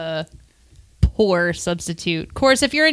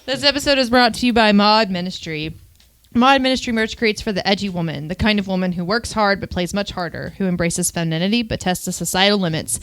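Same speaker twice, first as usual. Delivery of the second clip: scripted sponsor read, evenly paced; 2 semitones lower, faster than usual. Acted. Podcast host reading a sponsor spot to listeners, promotional.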